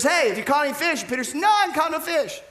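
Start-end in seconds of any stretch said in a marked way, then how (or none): 0.0-1.0 high call from the shore. Acted. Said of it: "who yells out there and says". 1.3-2.4 high, annoyed holler back; calling back. Acted. Peter calling back from the boat.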